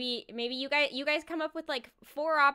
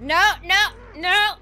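shout; 5 semitones higher, 12 dB louder than usual. Real, not acted. Game mishap.

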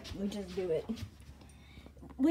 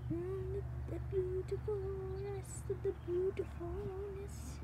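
high, soft over-the-top gushing; hammed-up gushing; mock gushing; 10 semitones higher, 5 dB quieter than usual. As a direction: gush like a game show host.

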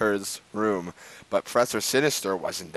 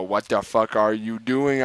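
ordinary talk, nothing marked

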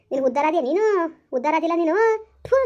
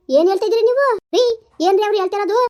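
high, raised-voice disbelief; shocked demand; 4 semitones higher, 4 dB louder than usual. Acted. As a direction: demand an explanation from your husband. Shocked wife confronts husband. (ಆಘಾತದಿಂದ ಜೋರಾಗಿ ಪ್ರಶ್ನಿಸುವ ಧ್ವನಿ)

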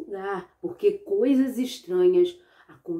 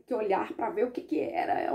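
ordinary delivery, nothing marked

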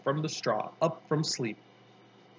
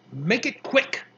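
irritated, higher-pitched order; grudgingly allow it, impatient; a touch of angry growl; 6 semitones higher than usual. A narrator's reading. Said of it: "she growled".

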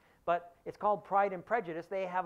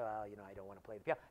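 softer, lower and quick, politely declining; polite refusal; 9 semitones lower, 7 dB quieter, much faster than usual. Retold or imitated. Elizabeth politely declines Lady Catherine, lower, softer and quick. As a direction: murmur it fast and low, ever so polite. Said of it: "Elizabeth is very polite and says".

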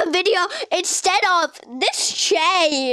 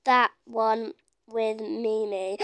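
slow, low, word by word; typing aloud; 6 semitones lower, 5 dB quieter, slower than usual. Real, not acted. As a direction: say each word separately as you type it. Sounding out words while typing.

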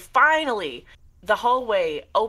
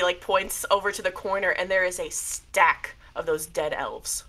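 ordinary talk, nothing marked

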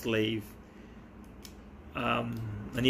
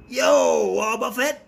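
excited; 10 semitones higher, 8 dB louder, slower than usual. Real, not acted. Pulls a card he wanted.

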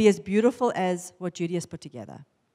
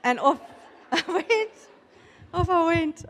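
high, giggly retelling; laughing; 8 semitones higher than usual. Real, not acted. Recalling her flustered exit from the chiro, laughing. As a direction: laugh through the words.

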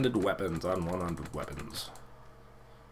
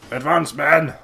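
loud, higher call to charge; battle command shout; 4 semitones higher, 8 dB louder, slower than usual. Pretend. Player ordering in-game troops forward, shouted command.

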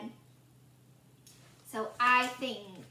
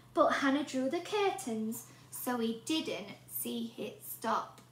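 usual speaking voice

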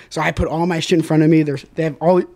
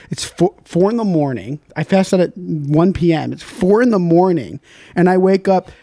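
ordinary talk

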